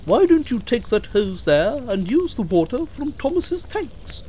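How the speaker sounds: high-pitched coach voice; helpful, high little coach; Annie. Acted. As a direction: suggest it in a high piping voice. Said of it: "said Annie, the coach".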